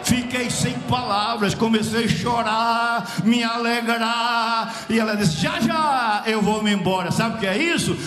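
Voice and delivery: loud, drawn-out revival cadence; impassioned preaching. Real, not acted. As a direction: proclaim it at full force, stretching key words. Preaching at full force.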